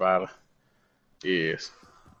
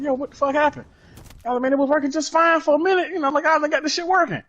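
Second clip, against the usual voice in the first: higher, put-on exasperated voice; faster than usual. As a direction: sound baffled and fed up.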